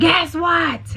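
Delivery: sudden high yell; yelling at the kids. Retold or imitated. Mom yelling at her kids, sudden high yell. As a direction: call it out to the kids. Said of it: "I yell for everything".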